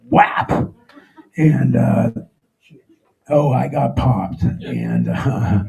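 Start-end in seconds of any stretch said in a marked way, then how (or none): none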